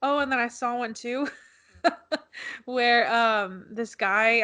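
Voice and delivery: ordinary talk, nothing marked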